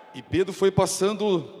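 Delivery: normal talking voice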